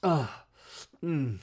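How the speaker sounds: mock moan of enjoyment, then a hum; imitated eating groan. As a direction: mimic sighing and groaning over a mouthful. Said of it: "groaning while she was eating".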